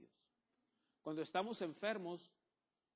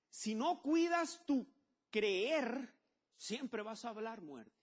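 higher, louder, slow stern warning; 6 semitones higher, 4 dB louder, much slower than usual. Acted. Pastor warns the church, higher, louder and slow. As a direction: drag it out as a warning. Preaching emphasis.

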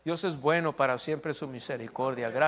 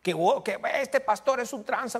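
higher, lightly mocking gossip voice; mocking a critic; 6 semitones higher than usual. Preacher mimicking a critic who calls him a crook, mild mock voice. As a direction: play the critic sneering a little. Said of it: "me dijo".

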